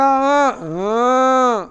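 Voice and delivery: loud, high braying groan; hammed-up animal cry; mock donkey bray. Shown as a demonstration. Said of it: "לא, זה קול של פרה".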